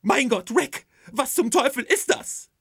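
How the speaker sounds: very high, loud shriek of disgust; wife shrieking at Rick on finding the skeleton, very high and loud; disgusted scream. Acted. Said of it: "schrie sie vor Ekel auf".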